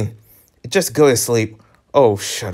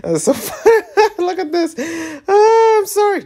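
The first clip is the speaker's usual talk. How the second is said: high squealing laughter through words; laughing hard. Real, not acted. Player laughing hard at a game scene, squealing. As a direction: crack up while talking.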